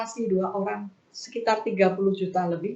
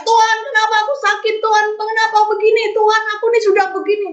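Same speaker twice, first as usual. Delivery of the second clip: high, loud, rapid cry of protest; crying out in complaint; 10 semitones higher, 5 dB louder, much faster than usual. Acted. Sick believer complaining to God, crying out. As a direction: fire desperate questions up at God. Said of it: "saya bukan teriak".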